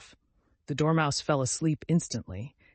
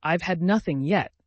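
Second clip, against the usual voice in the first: offended; 5 semitones higher, 6 dB louder than usual. A narrator's reading. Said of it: "in an offended tone".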